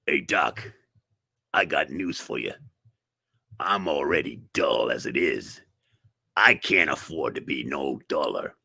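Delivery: very low, soft dopey voice; low, dopey mock-dullard; dim-witted patient. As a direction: play a dull fellow talking to his doctor. Dull slow patient answering doctor.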